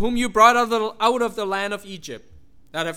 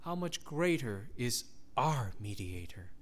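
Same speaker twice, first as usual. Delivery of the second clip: hushed reverence; 11 semitones lower, 12 dB quieter than usual. Real, not acted. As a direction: let it fall to a hush. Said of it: "soft reverent repeat".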